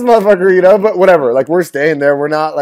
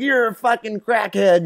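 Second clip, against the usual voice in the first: slow mock taunt; 7 semitones higher, slower than usual. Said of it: "We're like".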